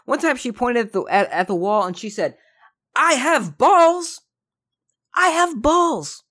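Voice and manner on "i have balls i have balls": high, slow, foreign-teacher voice, far higher; teacher's proud claim. Retold or imitated. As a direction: proudly declare it in a much higher put-on voice, slowly. Teacher quoted pointing at the wall.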